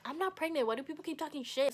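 mock-exasperated denial; indignant denial. Arguing against pregnancy rumours.

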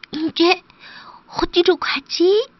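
high, slow little duckling voice; lost little duckling. Acted. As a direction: wonder helplessly in a high, small creature voice. Lost duckling wonders where to go.

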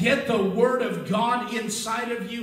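loud, rising sermon delivery that swells toward a shout; a pastor pumping faith into a hurting crowd, loud and rising; full-force preaching. Acted. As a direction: preach it hard to the congregation.

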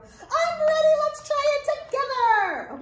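high, bright little monkey puppet; squeaky puppet voice. Acted. Librarian voicing Arturo the monkey puppet to the children, high excited puppet voice. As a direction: pitch way up and chatter like an excited puppet.

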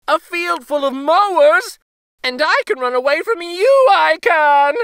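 high, loud and fast taunt; laughing gingerbread boy. A narrator's reading. Gingerbread boy taunting the cow, high fast bragging. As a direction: brag quickly with a laugh.

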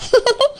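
quiet giggle to herself; small, private happy laugh; soft giggle. Acted. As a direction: giggle softly while daydreaming. Bride daydreams about her groom.

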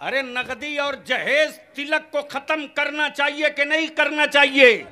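high, forceful shout to rouse the crowd; loud rallying question. Real, not acted. Preacher asking the crowd again about ending dowry, loud rallying shout. आवाज धीरे से आ रही है.

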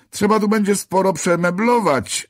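high, loud grandiose calling out; called out. A narrator's reading. Angel proclaiming his plans to the narrator, high loud call. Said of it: "zawołał".

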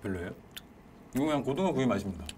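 talking while chewing food; muffled speech between bites; mouth full. Real, not acted. Seen 4: Man on right chews with full cheeks throughout the labelled words.

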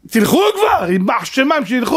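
high-pitched, impatient curse; acted exasperation. Hiding father watching the looters, acted exasperated curse. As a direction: burst out as the hiding man willing them gone. Hiding man wishes attackers gone.

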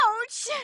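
a quick, high ouch; sharp yelp of pain. Acted. Amy yelps when she takes a hit. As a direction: yelp as you get hurt.

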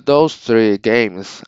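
normal talking voice

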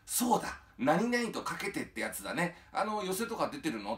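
suddenly remember and perk up; remembering old man. Retold or imitated. 思い出したおじさんが芸人に聞く、高めの明るい声. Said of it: "って言ったら".

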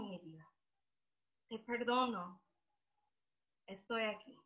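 soft, spaced-out tender reassurance; gently calm a sobbing child, pausing often; soothing mother. Retold or imitated. Mother hugging her crying daughter, tender and hushed.

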